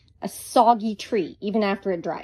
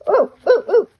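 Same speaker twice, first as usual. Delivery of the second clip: high and loud little oohs; excited oohs; 11 semitones higher, 8 dB louder than usual. Real, not acted. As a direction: squeal with delight at the speed. Excited over fast painting.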